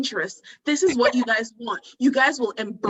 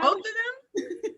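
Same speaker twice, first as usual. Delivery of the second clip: surprised; 10 semitones higher, 5 dB quieter, much slower than usual. Real, not acted. Surprised protest.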